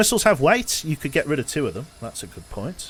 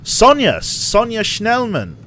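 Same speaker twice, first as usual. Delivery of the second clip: excited call-out; 11 semitones higher, 10 dB louder, much slower than usual. Real, not acted. Spotting the big bounty target.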